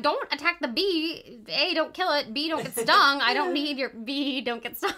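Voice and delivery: retold frantic pet-owner warning; exasperated scolding. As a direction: scold the cat urgently, half laughing at it. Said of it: "and I'm like".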